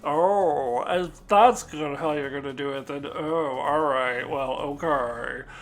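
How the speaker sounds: loud, huffy, put-upon droid voice; snooty sarcastic droid. Retold or imitated. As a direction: scoff like a snobby butler. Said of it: "so snooty and sarcastic".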